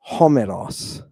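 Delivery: "Homeros" stressed on the first syllable "Ho", then falling away; "Homeros" with the accent three from the end; correct accent. Shown as a demonstration. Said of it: "Look where the accent is".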